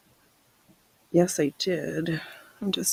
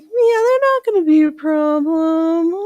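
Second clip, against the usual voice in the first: breezy, dismissive official's voice, much higher; mock official; 9 semitones higher, 6 dB louder than usual. Planners waving off the risk, mocking and high. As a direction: brush off worries like a careless planner. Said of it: "And they're like".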